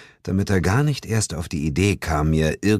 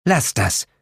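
called out; 8 semitones higher than usual. A narrator's reading. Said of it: "rief sie".

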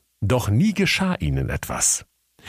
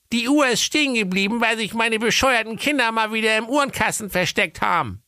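mother's voice. A narrator's reading. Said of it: "sprach da die Ingeborg Keule".

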